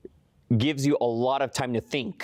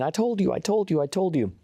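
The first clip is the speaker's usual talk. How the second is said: mild mock yell, pitched up; mock scream; 7 semitones higher than usual. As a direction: imitate an excited woman repeating herself. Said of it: "and she was screaming".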